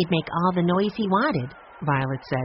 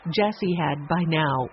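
ordinary delivery, nothing marked

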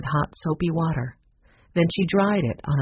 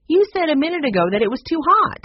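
high, fast, indignant objection; exasperated protest; 11 semitones higher, 4 dB louder, faster than usual. Acted. Maggie catches Aunt Jane contradicting herself, exasperated. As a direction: throw the contradiction back at her.

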